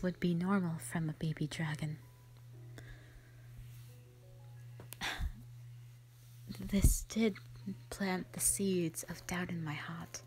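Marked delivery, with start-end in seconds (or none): 4.4-5.9 quiet release of breath; soft sigh. Acted. Sister troubled by the silent hatchling, soft sigh. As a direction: sigh softly to yourself. Recalls the strange baby dragon.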